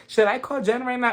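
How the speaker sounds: high-pitched imitation of a housewife's question; mimicked Heather. Recapper correcting who asked, mimicking her high-pitched. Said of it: "Heather was like".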